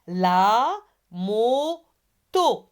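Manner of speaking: LA MOTO very slowly, long gaps between la, mo, to; syllable by syllable. Shown as a demonstration. Teacher repeats for pupils, very slowly syllable by syllable. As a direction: stretch each beat of "La moto". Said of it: "donc on va répéter".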